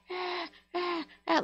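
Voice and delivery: high, thin imitation of screaming kids; mock kids' scream. Neighbor imitating kids screaming bloody murder, high mock shriek. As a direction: shriek at a held-back volume, far above your own pitch. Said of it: "like, screaming, like".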